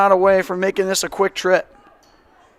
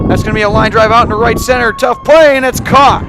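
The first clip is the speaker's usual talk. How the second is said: fast, loud, climbing higher into the catch; excited home run style call; 4 semitones higher, 9 dB louder than usual. Real, not acted. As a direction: shout it as the ball flies and is caught. Line drive caught, final out.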